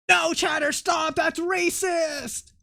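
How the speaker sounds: high, drawn-out mock protest; mock outrage. Streamer refuses chatter's Jamaican accent request in mock outrage. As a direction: scold in a put-on high voice, stretching the ending.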